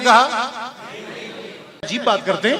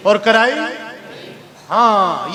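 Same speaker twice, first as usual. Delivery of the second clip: normal talking voice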